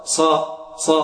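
"ص" as a thick emphatic "sa"; heavy sin. Shown as a demonstration. Teacher isolates the mistake, heavy "ص". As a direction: thicken the s of "ص", dark vowel. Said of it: "لا نقول".

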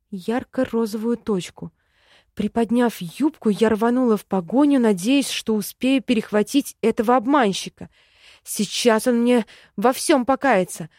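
plain reading voice

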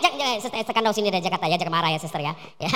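low, soft placating; calm her down gently; soothing. Acted. Preacher soothing an angry sister, soft and low. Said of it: "calming the imagined angry woman".

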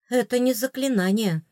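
usual speaking voice

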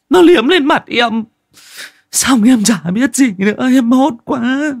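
high, loud, panicked female voice; frightened woman's telling. Acted. Wife telling the crew what she saw, terrified and trembling. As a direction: pour out the horror still shaking. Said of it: "chị Giang vẫn cứ run rẩy kể".

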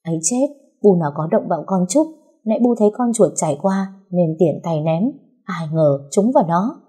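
usual speaking voice

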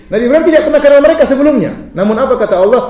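loud, high, fast sermon opening; preaching. Acted. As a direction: preach it out with fervour. Preaching at full force. (ceramah berapi-api)

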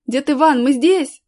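loud call to the rescuer; shouting. A narrator's reading. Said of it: "закричал Колян". (громкий крик, зов)

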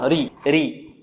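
"ري" with a thin R into a long i; light ra. Shown as a demonstration. Teacher shows the kasra case, light ra. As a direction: keep the R of "ري" light. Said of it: "وترقق إذا كانت".

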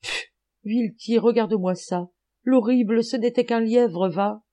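high, relieved exclamation; joyful exclamation. A narrator's reading. Schultz seeing the monster is a hare, joyful exclamation. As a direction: exclaim high with mild joy after a scoffing puff. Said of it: "il s'écria plein de joie".

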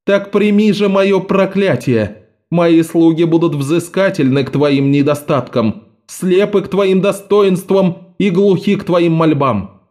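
high, slow, cold curse; cursing judge. A narrator's reading. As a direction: lay the curse with contempt.

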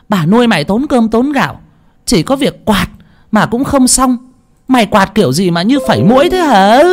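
high-pitched berating mistress; rising, indignant tirade; angry scolding. A narrator's reading. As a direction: tear into the useless maid. Mistress scolds maid after slapping her.

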